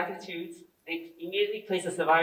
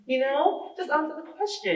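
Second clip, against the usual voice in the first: put-on soft, higher-pitched British accent; politely restrained British voice, quoting oneself; 8 semitones higher, 3 dB quieter than usual. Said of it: "my British accent came out then".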